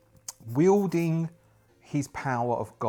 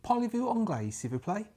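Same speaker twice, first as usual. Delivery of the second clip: French phrase; 9 semitones higher, 4 dB quieter than usual. Shown as a demonstration. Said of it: "the most important one that I can say".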